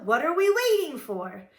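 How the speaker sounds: high, loud impatient question; excited kitten rallying; eager urging. A narrator's reading. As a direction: push the gang to get moving. Eager Skipito urges pack.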